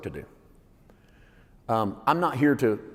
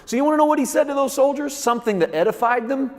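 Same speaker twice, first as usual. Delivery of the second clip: much higher, louder fervent preaching; preacher crying out to the congregation; preaching at full force; 13 semitones higher, 7 dB louder than usual. Acted. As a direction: build it into a roaring sermon climax.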